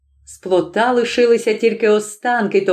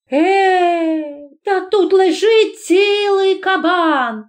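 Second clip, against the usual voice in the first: high, long-drawn shriek of delight; thrilled high-pitched announcement; joyful cry; 9 semitones higher, much slower than usual. A narrator's reading. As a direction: cry out slowly in amazed joy. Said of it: "закричала вона і сплеснула з радости".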